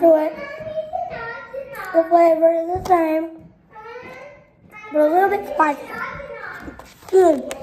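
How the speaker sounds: talking between bites; chewing while talking. Real, not acted. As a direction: mouth partly full. Seen 0: Ate chips just before; jaw and lips show chewing between words.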